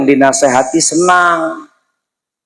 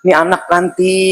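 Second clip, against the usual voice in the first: ordinary talk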